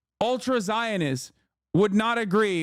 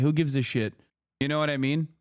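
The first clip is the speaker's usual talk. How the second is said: usual speaking voice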